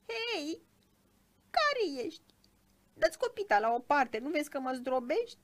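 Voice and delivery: high, loud, aggrieved little creature; small upset child voice. A narrator's reading. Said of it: "o voce mică și necăjită, ca de copil". (voce mică și necăjită, de copil)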